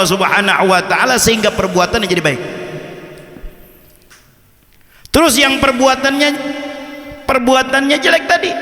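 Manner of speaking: high, sustained lecturing voice; preaching. Acted. Preaches the imam's explanation.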